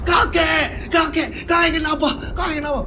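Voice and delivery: high, alarmed cries for grandpa; a grandson crying out to his convulsing grandfather; panicked calling. Acted. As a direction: call out in panic.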